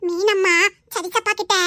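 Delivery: normal talking voice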